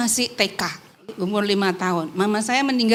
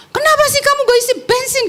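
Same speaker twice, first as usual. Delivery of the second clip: high, fast, angry shout at her sister; angry scolding; 13 semitones higher, faster than usual. Retold or imitated. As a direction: snap at the sister over the empty tank. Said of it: "Saya marah-marah gitu". (bentakan marah dengan nada tinggi dan cepat)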